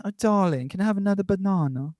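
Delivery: a hint of a glamorous pop star asking sweetly; lightly voiced glamorous British singer. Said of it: "imagine [name] saying".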